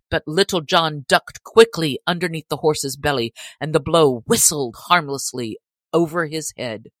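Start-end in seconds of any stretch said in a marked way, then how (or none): none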